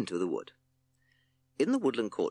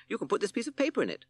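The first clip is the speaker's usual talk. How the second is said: chirpy bird, much higher and fast; 14 semitones higher, faster than usual. Acted. Captain Beaky offers the paper, high and quick. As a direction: rattle it off high like a little bird.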